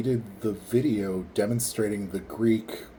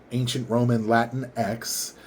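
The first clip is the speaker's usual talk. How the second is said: ordinary talk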